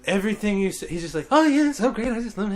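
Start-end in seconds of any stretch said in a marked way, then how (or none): 1.3-2.5 high, bright-eyed mock cheer; chipper colleague. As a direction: imitate an over-happy new teacher, pitched high. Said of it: "kind of annoying with how happy he is".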